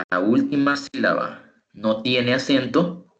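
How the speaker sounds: ordinary talk, nothing marked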